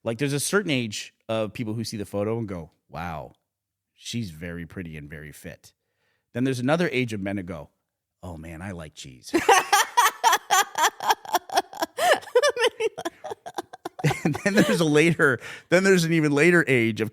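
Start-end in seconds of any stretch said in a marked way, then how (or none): 2.9-5.7 low, soft admiring murmur; hushed mock admiration. Men admiring the gymnast's photo, hushed. 8.2-9.3 low, very soft murmur; hushed mock longing. Older man eyeing the photo, quietly smitten with cheese. 9.4-14.2 high, long peals of laughter; big laugh. Real, not acted. Laughing at own cheese joke, high and long.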